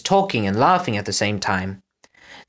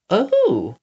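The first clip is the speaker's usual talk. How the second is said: taken aback; 8 semitones higher than usual. A narrator's reading. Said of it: "drawing back slightly".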